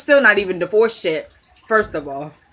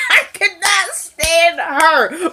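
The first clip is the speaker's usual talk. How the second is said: laughing; 6 semitones higher, much slower than usual. Real, not acted. Laughing slow comment.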